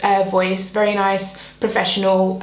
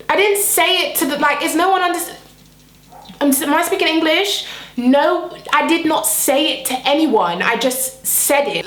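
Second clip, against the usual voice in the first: exasperated protest; 7 semitones higher than usual. Retold or imitated. Indignant protest to the manager.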